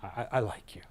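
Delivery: softly whispered, shy and agreeable; hushed, hesitant acceptance; timid whisper. Retold or imitated. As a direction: breathe it out like a cautious client warming up. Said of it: "The S is like".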